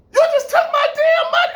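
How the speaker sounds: loud, high-pitched indignant yell; outraged shout. Retold or imitated. To the landlady who kept his money, a furious shout. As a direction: explode at being cheated.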